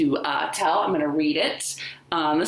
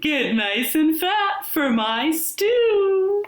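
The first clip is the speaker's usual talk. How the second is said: sly wolf calling out to the chicken; wolf crying out; 11 semitones higher, slower than usual. A narrator's reading. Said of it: "he cried".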